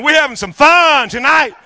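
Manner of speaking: high, loud, exultant cheer; gleeful exclamation. Retold or imitated. Pastor delighted his gift card prank worked, gleeful shout. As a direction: shout it out with glee.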